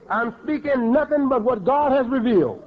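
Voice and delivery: ordinary talk, nothing marked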